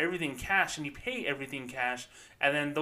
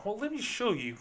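higher put-on helpful banker; cheesy bank rep voice; fake sales voice; 7 semitones higher than usual. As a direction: oversell it sweetly. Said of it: "That sounds like that fake voice".

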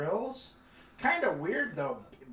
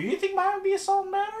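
surprised; 6 dB louder, much faster than usual. Real, not acted. Incredulous, high-pitched surprise.

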